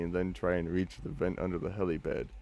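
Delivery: usual speaking voice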